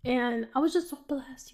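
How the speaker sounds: ordinary talk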